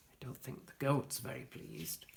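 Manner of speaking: put-on hushed whisper to the child; whispered aside. A narrator's reading. Narrator whispers to the listening child. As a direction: share a secret about the goat.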